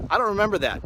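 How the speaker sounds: high, loud mock gruff denial; trooper quote. Trooper at the state fair, high mock denial. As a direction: shrug it off like the old cop.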